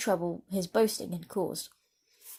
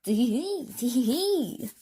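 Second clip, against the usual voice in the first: high impish giggle; a sly little goblin titter; mischievous little man's tee-hee; funny little laugh; 4 semitones higher, slower than usual. A narrator's reading. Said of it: "she heard a funny little laugh".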